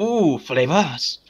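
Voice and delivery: slow, drawn-out ooh of delight; awed alien voice. Acted. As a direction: savor the word like a treat. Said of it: "pardon, pardon, forgot the".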